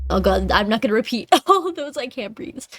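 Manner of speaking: ordinary delivery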